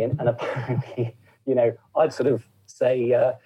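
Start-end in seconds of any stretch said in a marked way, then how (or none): none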